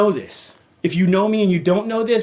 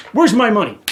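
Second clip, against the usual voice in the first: louder angry question; angry demand; 4 semitones higher, 5 dB louder than usual. Real, not acted. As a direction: demand it, heated. Ranting at the homeowners association.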